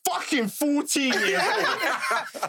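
put-on slow, laughing retort to a cocky kid; laughing disbelief. Older sister laughing at her fourteen-year-old brother, slow. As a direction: laugh the words out slowly in disbelief. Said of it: "I said".

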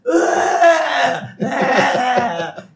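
high, loud mock wail; hammed-up cowardly yelling; loser's yell. Arrested husband waiving silence, hammed-up wailing yell. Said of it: "yelling like a loser".

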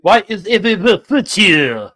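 slow, loud, hammed-up French accent; mock French accent. Mock French kitchen staff questioning the guests, French accent.

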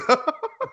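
short high-pitched laugh. Real, not acted. Joking about ring names, real high laugh. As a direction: giggle at your own joke.

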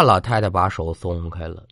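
plain reading voice

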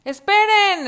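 high, loud wolf cry; high loud wolf shouting wait; exclaiming. Acted. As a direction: shout to stop them. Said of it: "exclamó el lobo".

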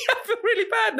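high, laughing confession. Real, not acted. Host admits feeling bad after his joke, laughing. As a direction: say it through real giggles.

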